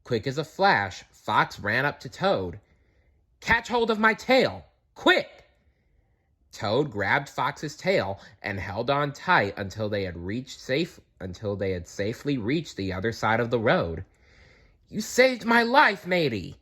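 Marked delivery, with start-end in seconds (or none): 3.4-5.5 high, pressing call; urgent call. A narrator's reading. Fox urging Toad to grab his tail, high and pressing. 14.9-16.6 gasping. A narrator's reading. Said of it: "gasped Toad".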